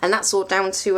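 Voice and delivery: usual speaking voice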